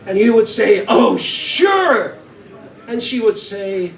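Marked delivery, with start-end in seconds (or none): 0.9-2.2 loud, eager yes. Retold or imitated. Guy leaps at the lady's invite, loud and eager. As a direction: jump at the offer, loudly. Eager reply in retold scene.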